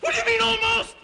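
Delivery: high, loud, indignant question; alarmed outburst. Acted. Policyholder hearing the copy is only almost exact, alarmed outburst. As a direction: yelp it, pitch shooting up.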